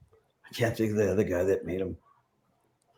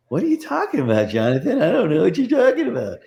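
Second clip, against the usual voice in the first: much higher, playing dumb with a laugh; mock innocence; 9 semitones higher, 4 dB louder than usual. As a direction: squeak it out like you did nothing wrong. Feigning innocence to the actor.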